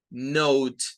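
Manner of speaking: Note with a long O and an audible final T; final T sounded. Shown as a demonstration. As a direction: say Note, silent E, ending on T. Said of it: "lo que suena al final es la T".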